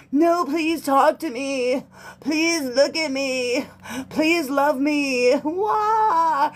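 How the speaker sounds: high, slow whiny pleading ending in a fake sob; mock begging cry. Reader mocks the clingy ex begging and fake crying. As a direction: beg pathetically then wail. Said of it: "It's like".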